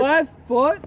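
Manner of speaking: high, loud angry shout; yelling in frustration; full on mad. Real, not acted. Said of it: "[name] is getting full on mad".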